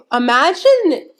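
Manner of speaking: usual speaking voice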